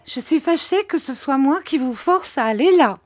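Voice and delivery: high, whining martyred voice; high complaining lament; plaintive widow. A narrator's reading. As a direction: sigh it out like a self-pitying widow. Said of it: "le rire le plus franc".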